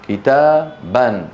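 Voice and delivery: كتابا as kitaa-ban, very slow; accusative tanween. Shown as a demonstration. Teacher shows the case endings, كتابا as kitaaban. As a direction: split كتابا and end it on a sounded -an. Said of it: "أو منصوب".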